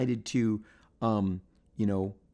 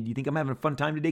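normal talking voice

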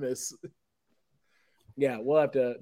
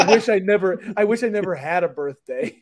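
whiny mock wail, louder; hammed-up sad cartoon mascot; mock sobbing Grimace; 4 semitones higher, 6 dB louder than usual. Retelling a meme, Grimace sobs on his birthday. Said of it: "He goes".

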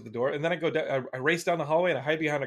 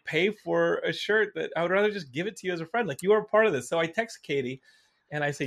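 normal talking voice

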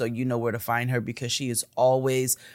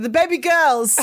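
high, loud mock British "baby girls"; mock British accent; 13 semitones higher, 7 dB louder than usual. Host quoting Kalechi, a high hammed-up British accent. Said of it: "she said".

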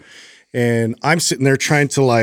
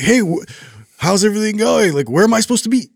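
high, overly bright small talk; forced cheer; 10 semitones higher, faster than usual. Retold or imitated. Dad greeting other parents, bright and lost. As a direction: fake friendly to other parents. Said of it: "I'm like".

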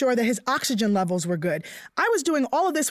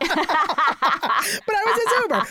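laughing; 5 semitones higher, much slower than usual. Real, not acted. Joking to Carrie about being her husband's driver: laughing. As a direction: laugh the words out, amused at herself.

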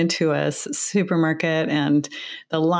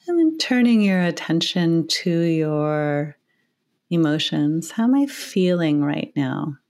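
guided meditation. Acted. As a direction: ease the listeners into relaxation, unhurried. Leads a meditation.

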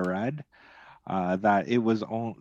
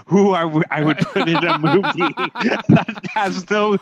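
higher, louder talk through laughter; can't keep a straight face; laughing at the casting suggestion; 10 semitones higher, 6 dB louder than usual. Real, not acted. Laughs while mocking the pick.

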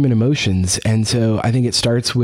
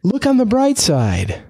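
glib consoling; 8 semitones higher than usual. Acted.

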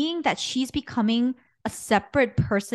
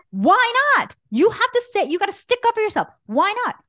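much higher, louder, urgent yell; yelling at her; 12 semitones higher, 5 dB louder than usual. Retold or imitated. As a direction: push her hard to stick up for herself. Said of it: "And I said".

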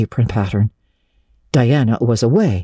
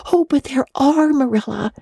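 much higher, eager protest; eagerly; 13 semitones higher than usual. A narrator's reading. Anne contradicting Marilla eagerly, high pitched. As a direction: cry it out with eager conviction. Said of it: "cried Anne eagerly".

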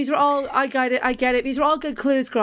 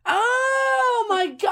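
drawn-out, high shriek of disbelief; a slow stunned cry much higher; shocked surprise; 12 semitones higher, much slower than usual. Real, not acted. Guest hearing the answer revealed, a slow high shocked cry.

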